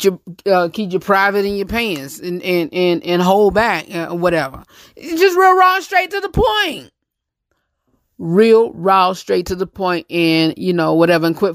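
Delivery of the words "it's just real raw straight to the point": catchphrase pitched much higher; high emphatic catchphrase. Real, not acted. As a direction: sing the slogan out up high.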